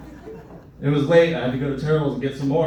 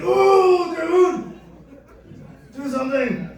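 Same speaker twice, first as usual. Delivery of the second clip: high, loud panicked squeal; panicked butthole voice; 13 semitones higher, 3 dB louder, much slower than usual. Acted. Comic voicing his own butthole, high panicked squeal. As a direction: squeal in panic like a desperate sidekick.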